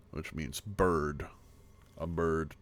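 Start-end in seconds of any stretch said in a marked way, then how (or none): none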